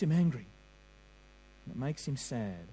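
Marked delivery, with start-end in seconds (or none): 1.7-2.7 much lower and softer, grieving; sad and hushed. Shown as a demonstration. Preacher to congregation on God's grief, hushed and sad. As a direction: let the word sad sink low and quiet. Contrasting God's anger and sadness.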